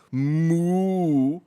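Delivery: a loud goofy cow call; mock moo. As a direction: belt out a silly moo. Retelling the cow moo bit.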